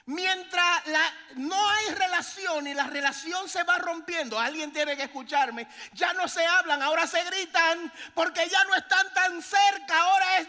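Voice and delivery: high, loud impassioned sermon; preaching at full force. Real, not acted. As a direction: drive the point home to the church.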